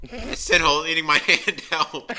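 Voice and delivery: laughing through a cry for help; panicked call broken by laughter. Acted.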